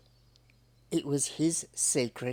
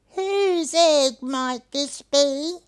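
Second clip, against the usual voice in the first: higher, louder, slow questioning bilby voice; puzzled little bilby asking around; Hunwick the bilby; 9 semitones higher, 6 dB louder, slower than usual. Acted. Bilby Hunwick asks neighbours.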